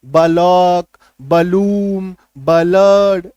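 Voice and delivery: बलक बलूम बलड with an extra vowel between b and l; wrong split pronunciation. Shown as a demonstration. Teacher demonstrating the wrong way to students. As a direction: break the cluster apart, the wrong way. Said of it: "ऐसे लेके नहीं चल सकते".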